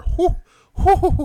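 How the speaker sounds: high, loud whews; whistling whew. Real, not acted. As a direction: whistle at the price. Reacting to taxi prices.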